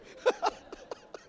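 breathy laugh. Real, not acted. Laughs after joke.

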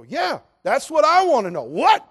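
high, loud exclamation; exasperated outburst. Retold or imitated. Suitor to his brother about her answer, exasperated shout. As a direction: burst out in loud bewilderment.

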